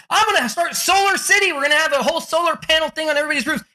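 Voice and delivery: high-pitched, rushing enthusiasm; Elon Musk, fired up. Retold or imitated. Entrepreneur announces his solar venture, fired up. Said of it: "Elon Musk got all fired up".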